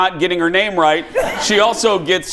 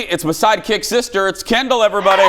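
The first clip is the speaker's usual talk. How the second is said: higher, projected announcer-style intro; rousing introduction; 7 semitones higher than usual. Real, not acted. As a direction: get the audience applauding your sidekick. Host introduces sidekick to audience.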